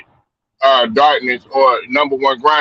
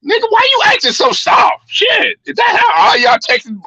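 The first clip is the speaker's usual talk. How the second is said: yelling auntie. Retold or imitated. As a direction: imitate a scolding New York auntie yelling.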